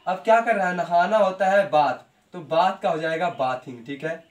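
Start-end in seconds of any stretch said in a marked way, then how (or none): none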